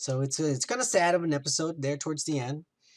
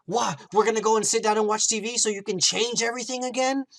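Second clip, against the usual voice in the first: retold indignant outburst, higher and quick; getting pissed; 6 semitones higher, 4 dB louder, faster than usual. Vision objects as Wanda ends the show, getting pissed. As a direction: act out exasperated disbelief in a raised pitch. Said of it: "he starts getting pissed".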